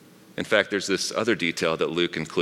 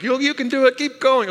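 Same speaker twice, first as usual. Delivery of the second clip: high, bright fake encouragement; mock cheerful parent; 4 dB louder than usual. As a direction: cheer the kid on in a squeaky high voice.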